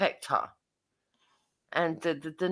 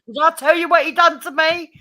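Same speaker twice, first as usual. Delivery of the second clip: mock complaint; 9 semitones higher, 9 dB louder than usual. Complaining to every visitor.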